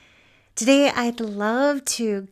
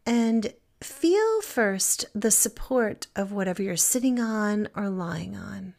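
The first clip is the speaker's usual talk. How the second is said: guided meditation. Acted. Teacher guiding listeners to feel supported and present, calm meditation voice. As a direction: settle them gently into stillness, phrase by phrase.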